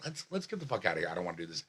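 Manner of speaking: soft, low, dropping away; defeated retreat. Retold or imitated. Bombed comic asks his girlfriend to leave, defeated. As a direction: let it sink to a dejected mutter.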